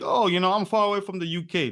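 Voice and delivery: a light mock whine about being far from home; mock complaint. A friend in New York mocking someone homesick for the UK, lightly whiny. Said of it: "if you say like".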